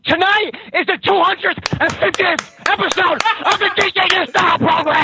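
tinny, distant payphone line; bad phone connection, shouting; payphone call. Real, not acted. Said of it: "sounds like you're on a payphone".

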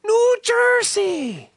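high, drawn-out Irish pirate cry; mock Irish pirate. Pirate repeating a tourist's home in a hammed-up Irish accent. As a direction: stretch it out like a hammy Irish pirate. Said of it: "he spoke like an Irishman".

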